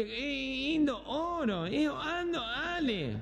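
high-pitched nasal mumble with dropped consonants; comic imitation of a gangoso customer; gangoso speech impediment. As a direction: talk through the nose, losing the first sounds. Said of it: "medio gangoso era".

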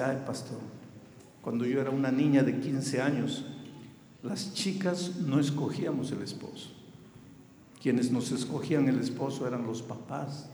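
normal talking voice

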